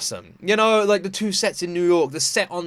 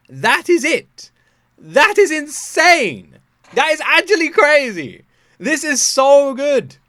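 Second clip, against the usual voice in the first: excited disbelief; 7 semitones higher, 7 dB louder, slower than usual. Real, not acted. Amazed at low views.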